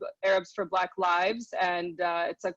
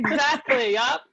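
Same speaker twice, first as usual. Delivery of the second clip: laughing; 5 semitones higher, 6 dB louder than usual. Real, not acted. Laughing at interruption.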